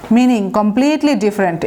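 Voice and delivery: ordinary delivery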